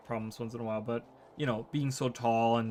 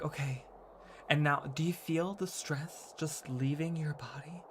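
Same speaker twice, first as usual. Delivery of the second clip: imitating a soothing ASMR yoga host, softly; 6 dB quieter than usual. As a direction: talk really softly, guiding someone to release stress. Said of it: "she would talk really softly".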